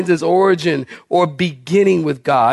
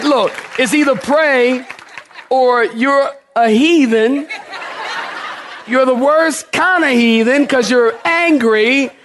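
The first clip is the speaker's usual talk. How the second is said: laughing tease; 7 semitones higher than usual. Real, not acted. Teasing the nurse, laughing.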